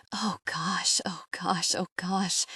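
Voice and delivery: soft, voiceless gasped whisper; breathy whisper. A narrator's reading. Narrator overwhelmed by his words, breathy whisper.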